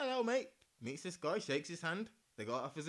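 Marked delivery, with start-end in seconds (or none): 0.0-0.5 high cheery greeting; bright fake friendly. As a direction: greet him brightly, putting it on. Quoting his own greeting.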